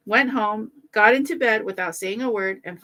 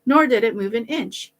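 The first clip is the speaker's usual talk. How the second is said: plain reading voice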